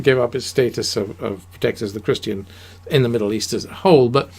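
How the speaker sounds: ordinary talk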